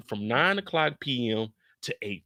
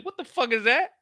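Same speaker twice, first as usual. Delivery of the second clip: much higher, startled what the fuck; Walt startled; 11 semitones higher than usual. Retold or imitated. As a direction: jump at the noise as Walt. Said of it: "Walt said".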